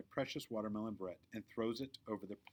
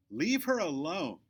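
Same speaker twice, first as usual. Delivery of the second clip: slow, high, loud command; booming bully girl; 7 semitones higher, 8 dB louder, slower than usual. Acted. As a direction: boom it right up his nose. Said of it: "Victoria booms".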